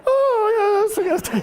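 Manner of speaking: embarrassed high yelp of agreement; sheepish agreement. Retold or imitated. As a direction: squeak it out, caught looking foolish. Embarrassed reply to Jim Brown.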